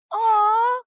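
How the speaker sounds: high, loud swooning aww; a loud high aww of delight. Real, not acted. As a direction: let out a big melting aww. Reacting to love confession.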